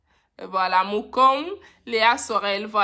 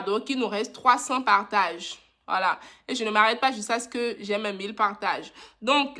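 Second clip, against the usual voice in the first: usual speaking voice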